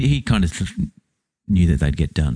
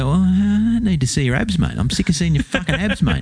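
high-pitched, exasperated mockery; sick-of-it comic rant; 11 semitones higher than usual. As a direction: whine in exaggerated disgust, stretching the words. Mock rant at gym show-offs.

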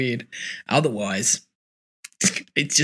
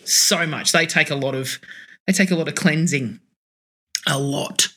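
ordinary talk, nothing marked

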